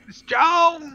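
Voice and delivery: high, far-off call. Acted. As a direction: call her name into the trees. Character calling out in forest.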